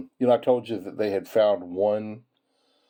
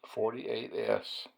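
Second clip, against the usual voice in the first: quiet savoring; 10 dB quieter, much slower than usual. Real, not acted. Savoring the find to himself.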